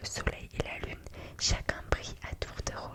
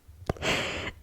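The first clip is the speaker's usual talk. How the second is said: whispered giggle. Real, not acted. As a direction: giggle under the breath. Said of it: "je rigole".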